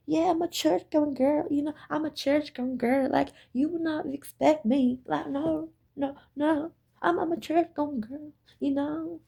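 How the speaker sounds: high, soft, sugary fake-pious voice; mock church-going girl. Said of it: "That's the facade they give you".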